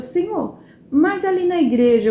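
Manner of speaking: apelo mais agudo a Deus; apelo recontado. Retold or imitated. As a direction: objetar a Deus com voz mais alta. Questiona Deus sobre a igreja. (apelo a Deus, recontado)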